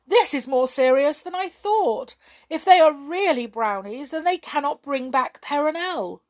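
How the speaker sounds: high, loud royal dismay; upset alarm. A narrator's reading. King realising Perenelle is lost, very upset. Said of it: "in a terrible upset".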